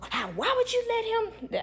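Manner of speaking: high, put-on accusing parent voice; mock shrill parent. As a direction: squeal the blame back at her. Parents' accusing question mocked.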